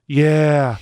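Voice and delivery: loud, drawn-out boss drone; imitated former boss. Boss confirming his excuse to employee, loud droning imitation. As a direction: hold the words long, like the boss. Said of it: "he used to talk like this".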